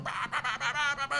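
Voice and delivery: high, soft babbling imitation of ranting; mock in-your-face ranter. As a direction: mimic someone yapping in your face. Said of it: "somebody's standing in your face going".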